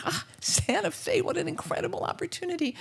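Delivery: high, dreamy exclamation of delight. Acted. Storyteller acting out her reaction to a job offer, delighted. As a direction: gasp it out high, marvelling at the chance.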